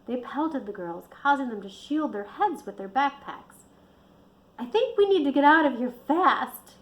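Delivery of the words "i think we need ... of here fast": loud urgent bellow. Acted. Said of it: "Kat bellowed".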